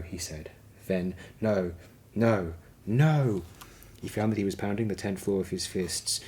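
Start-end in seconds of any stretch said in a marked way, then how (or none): 1.4-3.4 a rising, despairing shout of no; anguished shout. A narrator's reading. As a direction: let it tear out of him, louder each time. Paul breaks down, pounding floor.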